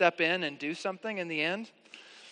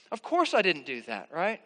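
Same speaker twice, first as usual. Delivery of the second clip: ordinary talk, nothing marked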